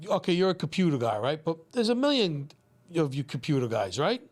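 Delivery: ordinary talk, nothing marked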